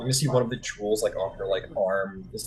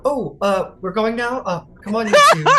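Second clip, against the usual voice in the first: high, louder, flustered hurry; flustered Thalia; 12 semitones higher, 10 dB louder than usual. Acted. Thalia hurrying the two along, flustered and high. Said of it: "Thalia's like".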